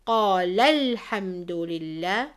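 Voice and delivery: "قال الحمد لله" recited with the lam after "قال" pressed hard; emphasized lam. Shown as a demonstration. Teacher demonstrating, recites "قال الحمد لله" stressing the lam. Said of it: "emphasizing the letter lam".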